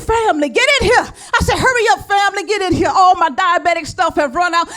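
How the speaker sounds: excited call to relatives; calling family. Retold or imitated. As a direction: holler for the family to come.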